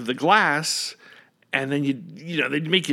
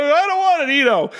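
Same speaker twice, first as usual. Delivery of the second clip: put-on very high, loud childish whine; whining kid refusal; 8 dB louder than usual. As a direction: refuse like a stubborn little kid. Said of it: "child refusing remedy".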